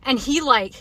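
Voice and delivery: ordinary talk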